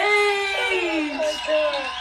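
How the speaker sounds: slow, loud and high, overwhelmed; excited exclaim. Real, not acted. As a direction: gasp it out in delight. Guest arriving overwhelmed.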